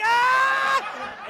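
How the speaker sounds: very high, loud laughing scream; hammed-up burst of shrieking laughter; acted laughing shriek. Comedian cracks up at boy's joke, acted laughing shriek. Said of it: "and I start laughing".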